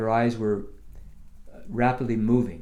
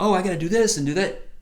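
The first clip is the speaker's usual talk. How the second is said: higher, louder mock to-do voice; imagined busy thought; 8 semitones higher, 6 dB louder, faster than usual. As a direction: rattle it off like a nagging thought. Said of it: "and you're thinking".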